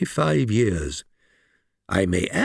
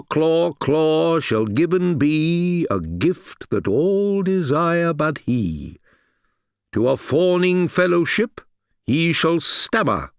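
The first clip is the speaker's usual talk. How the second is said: voice of the god; 5 semitones higher, slower than usual. Acted. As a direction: intone the prophecy as if possessed. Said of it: "in the voice of the god".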